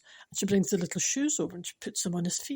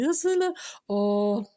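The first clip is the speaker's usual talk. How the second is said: normal talking voice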